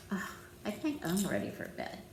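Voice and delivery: ordinary delivery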